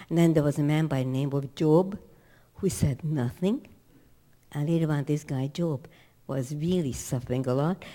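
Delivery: ordinary talk, nothing marked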